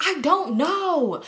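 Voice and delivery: slow, high, drawn-out shrug; helpless dodge. Retold or imitated. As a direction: stretch it out, playing dumb. Narrator answering Toya helplessly.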